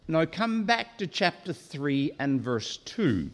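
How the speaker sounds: ordinary delivery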